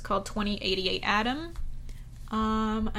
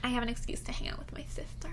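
whispering; 7 dB quieter, much faster than usual. Real, not acted. Quick excited whispered aside.

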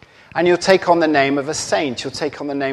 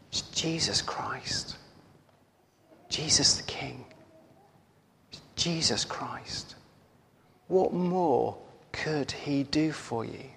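slow, whispery, reverent voice; near-whispered words from a vision; hushed retelling; 10 dB quieter, slower than usual. Retold or imitated. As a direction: speak it very softly and slowly, pausing between phrases.

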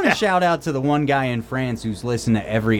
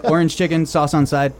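slightly higher mock Chinese-English phrasing; mock Chinese accent; 5 semitones higher than usual. Quoting his own restaurant order, mild mock Chinese accent. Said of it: "That just comes out that way".

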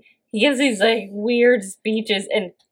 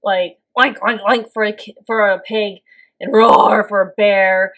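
normal talking voice

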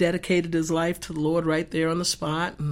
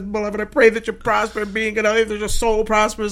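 loud, raised preaching prayer; 4 semitones higher, 10 dB louder than usual. Retold or imitated. Praying over John's arm, loud and fervent. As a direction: pray it out loud like a revival. Frantic quoting of scripture.

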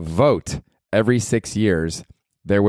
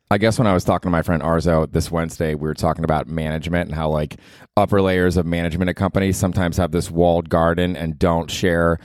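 usual speaking voice

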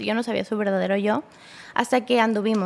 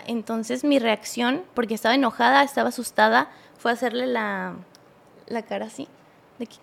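ordinary talk, nothing marked

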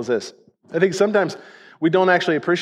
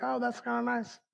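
very soft, higher aside; tempted musing; 4 semitones higher, 13 dB quieter than usual. Acted. Preacher acting out people toying with sin, soft tempted musing. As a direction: murmur it softly to yourself, intrigued. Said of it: "We're like".

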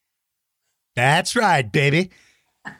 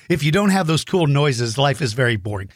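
ordinary talk

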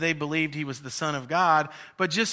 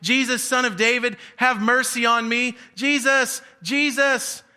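higher and louder, pleading shout; 7 semitones higher, 5 dB louder than usual. Retold or imitated. Blind man still calling Jesus, rising pleading shout. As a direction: keep crying his name over the crowd. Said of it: "must have still been kinda talking".

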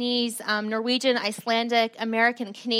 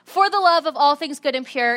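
retold outburst; 3 semitones higher, 6 dB louder than usual. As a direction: snap at Chris in exasperation. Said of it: "I snapped".

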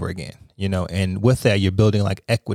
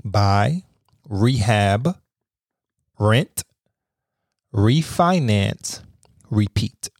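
slow; much slower than usual. Shown as a demonstration. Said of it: "spelling out the acronym slowly".

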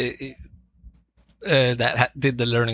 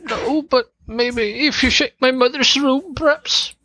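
put-on high-pitched girl's voice; much higher in-character voice; Sophia voice; 11 semitones higher than usual. GM voices Sophia giving a hint.

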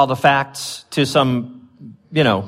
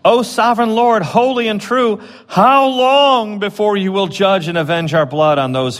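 high, raised, slow plea; crying out to God; 8 semitones higher than usual. Retold or imitated. The slain souls pleading with God, high and slow. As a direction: lift it up like a cry to heaven. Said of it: "they cried out with a loud voice".

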